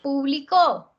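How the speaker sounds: publicó with the weight on the final CÓ; finishing the contrast, stressing the final CÓ of publicó; stress on last syllable. Shown as a demonstration. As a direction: land hard on CÓ at the end of publicó. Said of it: "pronúncialas y presta atención".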